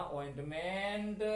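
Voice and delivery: "Ointment" drawn out slowly, syllable by syllable; teacher repeats "ointment" slowly; slow model word. Shown as a demonstration. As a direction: stretch "ointment" slowly for learners. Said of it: "क्योंकि हम language सीख रहे हैं ना".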